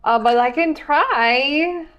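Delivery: hesitant, drawn-out worried reply; nervous uncertainty. Acted. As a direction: stall anxiously before offering help. Acted hesitant healer answering patient.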